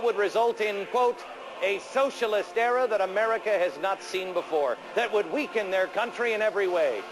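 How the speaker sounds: booming Soviet comrade orator; fiery revolutionary speech. Acted. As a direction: rouse the comrades like a party propagandist. Mock Soviet propaganda address.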